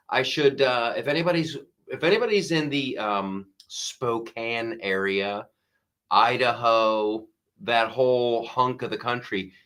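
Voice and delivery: ordinary talk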